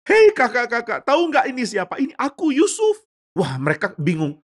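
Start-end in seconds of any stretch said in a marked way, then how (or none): 0.0-3.1 tearful reveal. Acted. Yusuf revealing himself to his brothers, tearful and high. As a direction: reveal yourself with a catch of tears. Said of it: "Lalu Yusuf menangis bilang".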